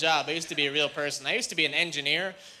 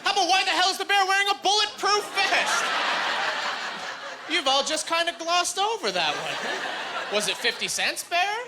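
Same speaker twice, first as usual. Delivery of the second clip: incredulous rant; 9 semitones higher than usual. Retold or imitated. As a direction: rant in outraged disbelief. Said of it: "looking around like".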